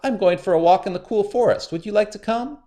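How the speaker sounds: sly spider, high and sweet; a crafty trickster sounding friendly; high, cunning spider; high Anansi voice. A narrator's reading.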